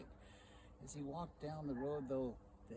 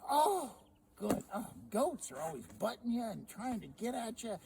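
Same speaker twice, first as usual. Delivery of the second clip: high, loud pleased wife voice; 7 semitones higher, 5 dB louder than usual. Acted. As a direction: brush off the goat cheerfully. Sally approves the sheep.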